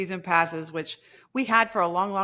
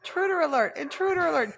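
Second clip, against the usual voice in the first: alarm shout; 10 semitones higher than usual. Pretend. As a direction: blare it like a ship's alarm. Mock alarm at barking dogs.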